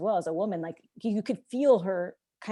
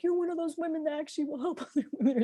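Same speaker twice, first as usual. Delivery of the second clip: high-pitched imitation of a drunk, emotional woman; retold amazed gratitude, pitched way up; tearful drunk woman; 8 semitones higher, faster than usual. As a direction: mimic her high voice with a laugh in it. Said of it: "the way she said it".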